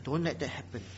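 low, soft, sober admonition; quiet, grave soul-searching; hushed solemn appeal. Real, not acted. Sober self-examining appeal to congregation.

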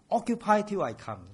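normal talking voice

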